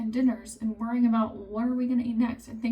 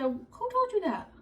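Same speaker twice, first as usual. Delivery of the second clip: high, startled demand; mock alarm, grilling the kid; who told you that pitched way up; shocked demand; 10 semitones higher than usual. Confronting son who denies Santa.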